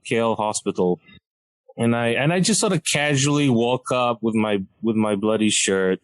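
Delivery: ordinary talk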